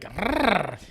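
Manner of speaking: short mock growl, rough in the throat. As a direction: growl it out like you're wrecked at dawn. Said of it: "and then you're like".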